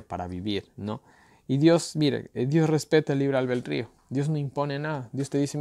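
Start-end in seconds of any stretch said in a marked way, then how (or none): none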